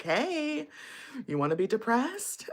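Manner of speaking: soft, high, sneaky coaxing; coaxing depression voice. Acted. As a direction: creep up and coax sweetly. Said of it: "it's still creeping up like".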